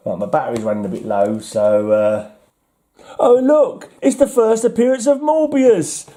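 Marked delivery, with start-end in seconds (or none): 3.2-6.1 excited. Real, not acted. Collector thrilled to find Morbius's first appearance, excited. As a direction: gasp with delight at the find.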